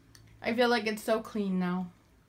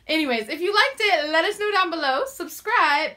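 vlog outro; 7 semitones higher, 5 dB louder than usual. Acted. Upbeat video outro.

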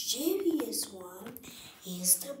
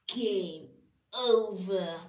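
usual speaking voice

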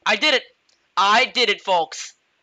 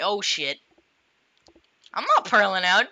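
ordinary talk

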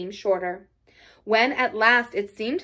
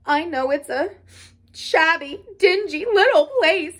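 halting acted sob, climbing higher; sobbing brokenly; 6 semitones higher, 5 dB louder than usual. A narrator's reading. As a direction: choke it out in broken bits, tearfully. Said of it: "he sobbed forth at last brokenly".

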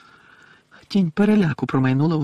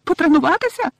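very high, loud elf squeal; indignant little elf shrieking; spiteful squeak; 6 dB louder than usual. A narrator's reading. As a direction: squeal it in outraged disbelief. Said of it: "злісно запищала Вінкі".

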